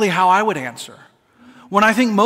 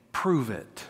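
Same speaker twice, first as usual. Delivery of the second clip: soft, low taunting dare; low sneering challenge; 6 semitones lower, 8 dB quieter than usual. Acted. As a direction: dare them quietly, almost under the breath. King taunts the three men.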